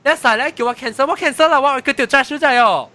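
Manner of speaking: high, loud exasperated retort; retold indignant protest. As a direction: replay an outraged complaint at a driver. Retells quarrel with Uber driver. (學家己大聲抗議、氣怫怫的口氣)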